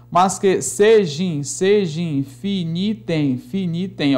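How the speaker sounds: slow scansion, "sejam finitem" fused across the word boundary; deliberate linking in "sejam finitem"; joined unstressed vowels. Shown as a demonstration. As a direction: stretch "finitem" with the vowels run together. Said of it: "Átona com átona, átona com átona, junta".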